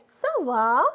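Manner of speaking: "Ça va" with the voice rising on "va"; lilting up on "va" to make "Ça va" a question; questioning intonation. Shown as a demonstration. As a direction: ask "Ça va" as a question, lifting the end. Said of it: "There's intonation".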